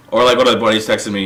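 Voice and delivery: usual speaking voice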